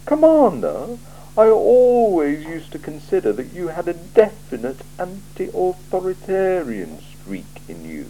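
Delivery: a cool ruler weighing every word; icy, deliberate interrogator; Lord Vetinari. Acted. Vetinari dry reproach.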